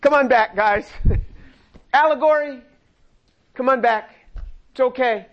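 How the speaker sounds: hammed-up Jesus begging followers to come back; mock pleading call. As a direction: call the crowd back, half laughing. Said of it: "you would think Jesus would say".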